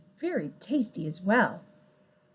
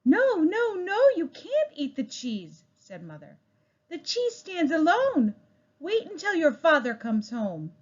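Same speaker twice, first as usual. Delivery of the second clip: high, sing-song scolding mother; a mother forbidding, pitched high; motherly no, no, no; 8 semitones higher than usual. Acted.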